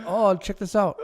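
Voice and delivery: loud hammed-up boast; loud playful. The imagined masseuse bragging loudly, hammed-up. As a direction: belt it like showing off a trick. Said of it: "she's like".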